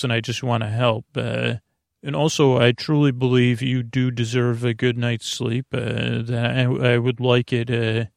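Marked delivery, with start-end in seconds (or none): none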